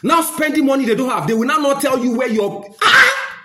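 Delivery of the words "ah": high, loud "ah!"; indignant cry. Real, not acted. Ranting about police spending money, indignant high cry. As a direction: break off into a sharp, high outcry. Exclaims in outrage mid-rant.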